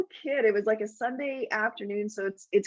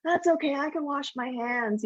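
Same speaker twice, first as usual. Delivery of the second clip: put-on higher, cheerful kid voice; four-year-old on the hill answering his mom, higher and breezy; stubborn kid; 6 semitones higher than usual. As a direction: brush mom off brightly like a small child. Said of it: "And he's like".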